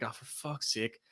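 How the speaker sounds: soft, fed-up mutter under the breath; muttered curse. Retold or imitated. Arriving at a packed bar, a muttered curse. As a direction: grumble it to yourself. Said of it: "I'm like".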